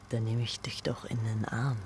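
soft voiceless whisper. Shown as a demonstration. Director demonstrating the embrace line in a whisper. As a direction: whisper it close and low. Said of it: "sagt er".

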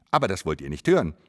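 ordinary talk, nothing marked

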